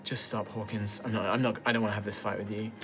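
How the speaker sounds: low and soft, tired of the fight; pleading him to drop it, hushed; a quiet, worn-out plea to a teasing teammate; weary and quiet. Retold or imitated. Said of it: "Tristan's just like".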